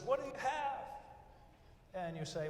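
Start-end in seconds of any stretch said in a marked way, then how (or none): 0.0-0.8 bright high eager question, much higher; cheerful server. Retold or imitated. Friendly server greets a diner, high and eager. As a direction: play the chirpy waiter greeting a diner. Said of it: "the server comes up and says".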